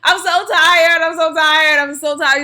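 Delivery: real tearful outburst, high and loud then fading; crying out tired. Real, not acted. Venting to viewers about overdraft fees, crying out loud then fading. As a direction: break down saying how tired you are, easing off. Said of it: "I'm frustrated right now".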